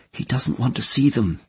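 ordinary delivery, nothing marked

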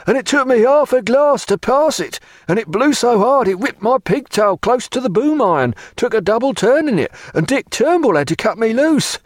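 high, emphatic seaman's yarn; high-pitched rustic sailor; sailor's character voice. A narrator's reading. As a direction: pour out the tale as an aggrieved sailor. Sailor Plum tells his mishap.

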